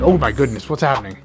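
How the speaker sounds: ordinary delivery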